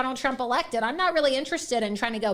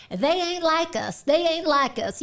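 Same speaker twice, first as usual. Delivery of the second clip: imitating Kamala; 3 semitones higher than usual. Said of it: "Just like she said".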